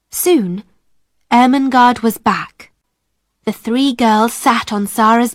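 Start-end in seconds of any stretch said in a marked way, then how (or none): none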